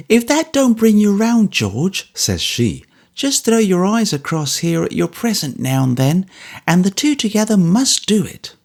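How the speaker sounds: high, coaxing wife's voice; Mrs Bagnet, coaxing. A narrator's reading. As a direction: cheer up a gloomy friend.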